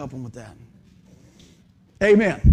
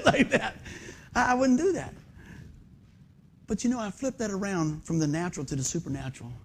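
usual speaking voice

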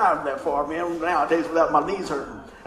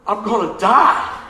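mock dying thought; 6 dB louder, slower than usual. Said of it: "And I thought".

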